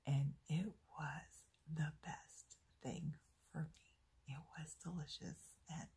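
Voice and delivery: hushed and breathy, sharing a naughty treat; confiding whisper. Real, not acted. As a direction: whisper it like a guilty secret. Confiding about sneaking a drink.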